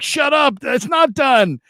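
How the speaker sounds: high, tense snap back; anxious retort. Retold or imitated. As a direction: shut him down, nervous about the lead. Arguing with friend over bet.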